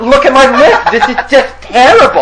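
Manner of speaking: high, loud panicked complaining; frantic neighbor. Retold or imitated. Beaten Russian neighbor showing his lip, high and frantic. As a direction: whine it high and loud like he's hurt.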